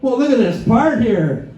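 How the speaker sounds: mock gasping at a car part; mock amazement. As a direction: overplay being impressed by the part. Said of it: "I'm like".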